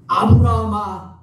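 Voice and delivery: loud, drawn-out summons; calling out. Acted. God calling Abraham to stop him, loud drawn-out call. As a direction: call the name loudly, stretching it slowly. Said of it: "뭐라고 부르세요?".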